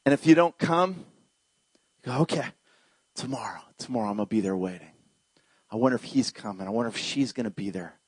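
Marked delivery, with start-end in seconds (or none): 2.2-8.0 low, hushed voice; soft, near-whispered patience; patient, tender Jesus. Acted. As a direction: murmur it softly to yourself, gently hopeful. Voices Jesus patiently waiting again.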